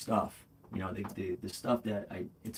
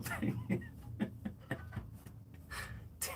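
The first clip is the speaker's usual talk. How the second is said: airy high laugh of disbelief; a grateful streamer laughs in disbelief at a gift; breathy disbelieving laugh; 10 dB quieter than usual. Real, not acted. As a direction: let out a surprised, breathless laugh.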